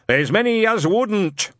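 slow, high, booming; crashing back loud. Acted. Shadwell warning Newton, suddenly booming slow. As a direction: crash back to full volume. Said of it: "crashed back to full volume".

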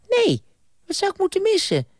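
put-on very high, fast indignant boy; childish Bram. Bram brushing off the ghost, very high childish voice. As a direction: squeak it back like a defiant kid.

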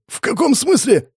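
very high, panicked question; alarmed disbelief. Acted. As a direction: squeak out the question in shock. Terrified victim questions a self-declared god.

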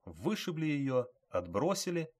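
usual speaking voice

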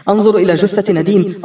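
ordinary delivery, nothing marked

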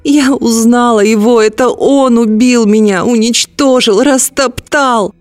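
high, slow hysterical cry; screaming. A narrator's reading. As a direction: shriek out the accusations. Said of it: "вдруг закричала Тарита".

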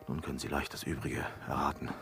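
quick, soft insinuation; hushed hint. Acted. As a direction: rush the hint under the breath.

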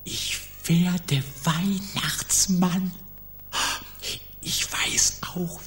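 hushed whisper after being shushed; excited but kept to a whisper. Acted. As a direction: whisper the joyful secret, softly.

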